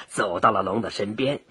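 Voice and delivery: plain reading voice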